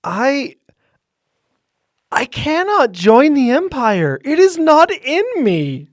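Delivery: dramatic refusal. Pretend. Mock refusal of the Empire.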